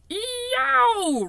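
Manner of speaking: high anguished howl of a giant in a nightmare; roar. A narrator's reading. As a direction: let out a loud, high, pained cry. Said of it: "roared the flesh lump eater".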